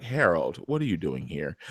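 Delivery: low, hushed, deadpan greeting; put-on Jake voice. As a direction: drop low and quiet, coolly confronting him. Voices Jake greeting man at door.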